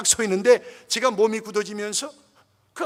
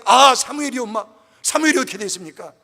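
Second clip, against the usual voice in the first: startled eager question; 3 semitones higher, 9 dB louder than usual. Retold or imitated. Startled pastor on phone.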